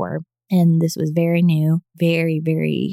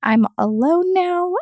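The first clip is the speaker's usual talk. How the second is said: a mild hint of crying, much higher pitched; tearful plea; 10 semitones higher than usual. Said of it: "And I just cried".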